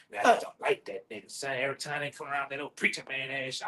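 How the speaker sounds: low, quiet mock complaint; grumbling detractor. Quoting viewers who dislike the preacher, low grumbling complaint. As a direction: voice a disgruntled critic under your breath.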